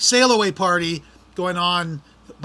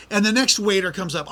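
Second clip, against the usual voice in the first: normal talking voice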